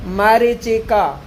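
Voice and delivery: drawn-out, syllable by syllable मरीचिका; slow clear dictation. Shown as a demonstration. Teacher to exam students, slowly spelling out the word. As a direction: dictate slowly so students catch each vowel. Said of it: "मरी बड़ी, चिक्का छोटा".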